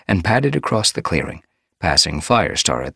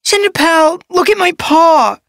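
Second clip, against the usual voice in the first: very high, slow, whiny wail; wailing kitten apprentice; 10 dB louder than usual. A narrator's reading. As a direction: wail for help like a hurt kit. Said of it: "a wail sounded".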